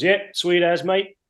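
casual New Zealand drawl, lightly imitated; broad kiwi accent. Retold Kiwi coworker's reply.

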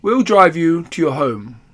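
a gracious king's offer; kingly voice. Acted. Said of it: "said the king".

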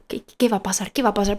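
a soft, anxious inner voice; retold worry. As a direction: whisper the worry to yourself. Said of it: "entonces digo".

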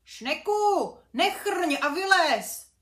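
loud, high yell, slow on the command; shouting. Acted. Brunda yells at the sleeping snail, loud and high. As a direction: bang on the shell and yell at the snail. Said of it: "klepal na domeček a křičel".

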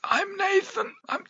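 put-on high, raspy old man voice. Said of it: "You would sound like a very old man".

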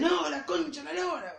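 mock his friend's angry swearing; angry outburst. Friend mimics Nico swearing at the Play, mock angry.

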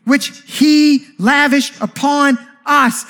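drawn out with wonder; savoring the verse. Acted. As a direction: stretch out lavished upon us.

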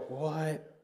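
soft, stunned, breathy "what"; a baffled murmur to himself; dazed disbelief. Retold or imitated. Bewildered reaction after anger.

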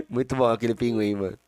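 ordinary talk

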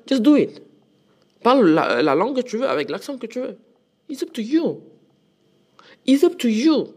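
rally the viewers, pitch up; emphatic urging. Real, not acted.

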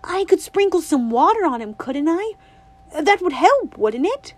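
put-on a bright little girl asking her granny; girlish and eager, lightly voiced; Winnie's voice. A narrator's reading. Winnie hopeful.

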